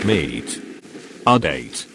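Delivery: ordinary delivery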